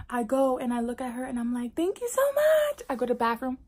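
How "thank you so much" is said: sugary thanks. Retold or imitated. Overly sweet thanks to mother.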